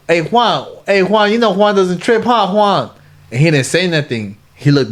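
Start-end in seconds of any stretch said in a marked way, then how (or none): 0.1-2.9 higher, coaxing mock banter; playful teasing. Coaxing an angry cellmate in front of everyone, high playful teasing. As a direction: coax him with light higher teasing.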